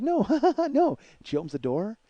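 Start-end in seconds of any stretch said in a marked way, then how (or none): 0.0-1.0 high, loud imitation with a cackle between words; laughing refusal. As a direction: cackle through the refusal, mockingly. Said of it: "she's laughing the entire time".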